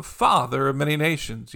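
normal talking voice

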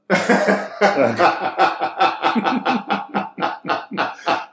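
high, loud cackling at own punchline; long laughing fit. Real, not acted. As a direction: crack up helplessly for several seconds.

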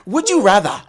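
loud, high put-on African accent, laughing through it; generic African accent. Reading the question in his generic African accent, laughing. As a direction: push the words out in a mock African accent, cracking up. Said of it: "my generic African accent".

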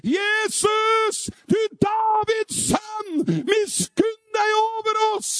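very high, loud, drawn-out shouted plea; full-throated crying out. Retold or imitated. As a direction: shout for mercy at full force, stretching the words. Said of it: "De ropte bare høyere".

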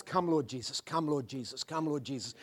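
hushed longing call; soft prayer. Acted. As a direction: breathe it like a quiet prayer. Soft prayerful refrain in sermon.

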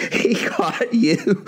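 high, drawn-out words through laughter; laughing mockingly in a retold scene. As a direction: imitate friends cracking up laughing. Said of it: "They start laughing".

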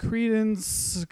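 high name that gives up halfway; trailing-off name. Retold or imitated. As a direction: start "Credence" strong, then let it fade. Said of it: "gave up halfway through".

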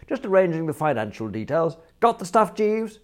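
high, loud breezy query; calling across. Acted. Prisoner calling to his valet in court, high and loud.

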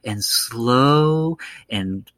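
imitating a slow, rhymey lure; soft, slow lilt. Describing the evil caller luring him in, slow and lilting. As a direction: draw the words out in a singsong lilt. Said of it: "he does that one thing where he's soft and slow".

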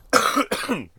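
sudden harsh cough; a real hacking cough; coughing fit. Real, not acted. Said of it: "I'm hacking over here".

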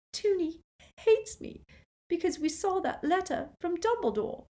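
very high, choked upset; constricted voice. A narrator's reading. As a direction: squeeze it out, close to tears. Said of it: "in a constricted voice".

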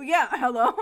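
loud rising hello, laughing. Pretend. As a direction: shout hello while laughing. Mimicking the phone shout.

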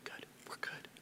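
barely voiced whisper of reassurance. Pastor silently reassuring a worried husband, whispered. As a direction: mouth it softly across the room. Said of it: "I'm looking at John going".

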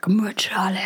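unvoiced whisper of the band name. Telling the boy about her shirt, whispered band name. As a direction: breathe the word out secretly. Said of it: "I was like".

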